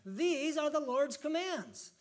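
slow, high, loud final proclamation; preached conclusion. Acted. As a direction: land it like a pulpit verdict. Closing the preached scripture reading.

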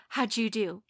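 normal talking voice

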